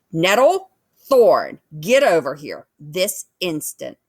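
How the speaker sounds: loud, commanding summons; mother bear ordering her cubs away from the fight; stern mother's call. Acted. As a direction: call the cubs to heel, no arguing.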